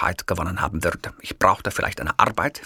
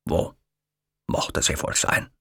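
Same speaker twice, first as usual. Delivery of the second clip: usual speaking voice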